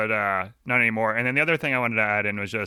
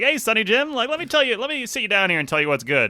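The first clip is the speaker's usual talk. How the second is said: mock condescension, pitched up high; lightly hammed-up, high-pitched patronizing address; condescending; 9 semitones higher than usual. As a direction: imitate someone talking down to a youngster. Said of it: "It's so condescending and so talking down".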